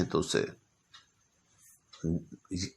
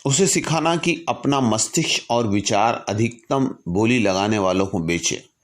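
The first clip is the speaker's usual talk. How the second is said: raised, earnest recitation of a letter; formal letter reading; 3 semitones higher, 5 dB louder than usual. Acted. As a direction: read it like a solemn public address. Reads Lincoln's letter aloud. (गंभीर भाव से पत्र का पाठ)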